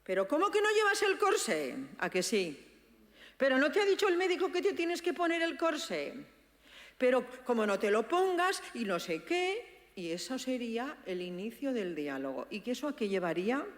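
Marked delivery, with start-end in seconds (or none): 0.0-9.7 high, loud exasperated scolding; scolding reproach. Shown as a demonstration. Daughter scolding her father about the corset, loud and exasperated. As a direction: nag him like an impatient parent. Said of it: "si no se la diera, empezaría".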